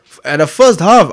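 ordinary talk, nothing marked

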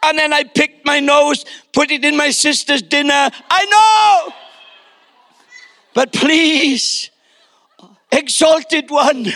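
put-on high, grovelling child voice; hammed-up abject little penitent; mock confessing son. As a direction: plead as if crawling on the carpet. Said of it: "confessing his sins, saying".

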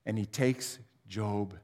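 usual speaking voice

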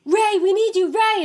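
high, loud urgent call. Retold or imitated. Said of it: "and they're like".

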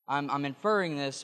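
ordinary talk

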